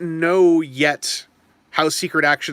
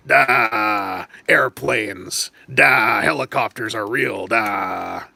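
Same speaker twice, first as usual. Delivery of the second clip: lower, slow, dopey put-on voice; [name] voice; 6 semitones lower, much slower than usual. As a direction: drone each "Da" low like a dim-witted guy. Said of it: "in that trademark [name] voice".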